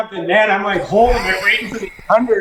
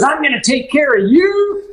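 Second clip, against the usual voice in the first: put-on generous drunk-ish client; 8 semitones higher than usual. Client tipping the guide after a good day, high grand voice. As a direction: hand out the reward grandly. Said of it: "he goes".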